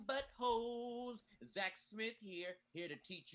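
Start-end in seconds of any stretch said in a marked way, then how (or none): 0.1-1.2 stretched out, pitched up; mock the kids slowly; drawn-out insult.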